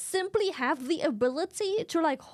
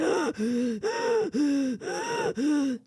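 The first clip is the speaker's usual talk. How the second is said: horse gasp. Retold or imitated. Horse sees the hunter.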